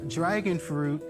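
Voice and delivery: ordinary talk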